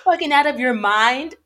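mock American accent. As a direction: toss it off in a blunt American accent. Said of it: "she said that very American".

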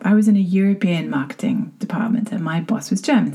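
ordinary delivery

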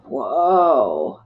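low, drawn-out stunned whoa; stunned reaction. Retold or imitated. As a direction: re-enact being floored, voice dropping. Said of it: "felt like he punched me between the eyes".

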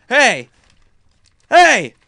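loud high hey, building; calling to wake. Retold or imitated. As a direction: holler to rouse a heavy sleeper. Retold rousing of snoring dad.

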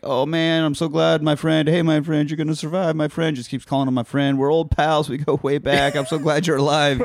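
butter him up with a fake grin; sweet talk. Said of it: "tries to sweet talk him".

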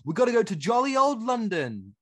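high-pitched, hammed-up British accent; mock British accent. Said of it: "do a British accent".

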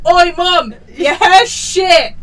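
shouted. Retold or imitated. Children yelling from the top of the stairs. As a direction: yell it down the stairs. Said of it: "children shout at you".

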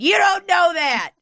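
high, loud outburst; shouting. Real, not acted. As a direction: shout it in protest. Outburst at the article's claim.

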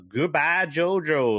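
lightly voiced anime villain; mock villain line. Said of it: "and you get the".

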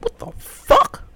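high, slow shocked yelp; startled what the fuck. Retold or imitated. Driver hearing a squelch, high startled yelp. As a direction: jump at the noise.

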